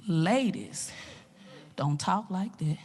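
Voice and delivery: low, quiet reprimand; deep-pitched, hushed teacher voice; stern coach. Retold or imitated. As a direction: imitate a coach softly scolding. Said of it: "he stopped me and he said".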